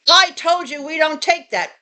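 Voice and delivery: mock exasperated outburst; retold going off. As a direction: imitate an irate man spitting an insult. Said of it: "he's going off on me".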